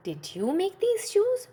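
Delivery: high, wondering question; puzzled husband asking his wife; shoemaker's question. Acted. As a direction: ask in surprise about the shoes.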